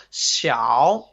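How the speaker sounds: "Xiao" dipping down and back up; third tone. Shown as a demonstration. Teacher showing the Chinese third tone, dipping. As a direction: dip "Xiao" low, then bring it up. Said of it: "is third tone".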